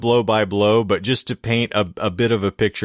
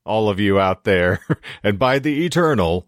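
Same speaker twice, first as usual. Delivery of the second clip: mock-grand proclamation. Host hailing the podcast's listeners, mock-grand.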